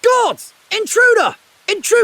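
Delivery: high, loud alarmed shout; yelling at an intruder in the night. Acted. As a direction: raise the alarm at full voice. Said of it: "shouting like that".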